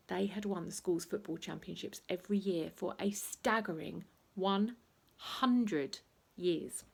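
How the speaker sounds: ordinary delivery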